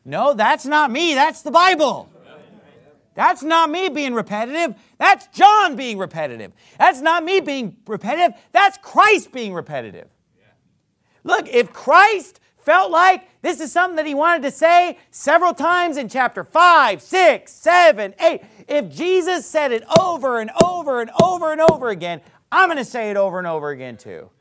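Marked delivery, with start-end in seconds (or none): 0.0-10.1 loud, high-pitched pulpit retort; emphatic raised preaching voice; forceful rebuttal. Real, not acted. Preacher rebutting an imagined objection. 11.2-22.2 high, loud pulpit shouting; impassioned raised-voice sermon; full-force preaching. Real, not acted. Preacher at peak of his argument.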